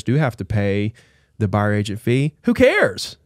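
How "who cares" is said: high squeaky shrug of a question; who cares. Real, not acted. To the co-host, brushing off the lawsuit, high and dismissive. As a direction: toss it off, pitched way up. Dismissing worst case scenario.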